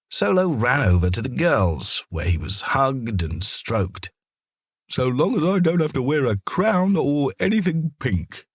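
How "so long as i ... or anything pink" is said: lightly voiced dog, a bit higher, wary; a dog bracing against being dressed up; dog's wary thought. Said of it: "he thought".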